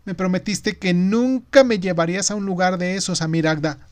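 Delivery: put-on hurt boy, voice about to break. A narrator's reading. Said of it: "Se le partía la voz". (voz de niño que se quiebra)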